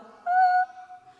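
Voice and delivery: sudden high loud yelp of alarm; startled yelp. Retold or imitated. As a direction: yelp in fright at the voice. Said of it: "You'd be like".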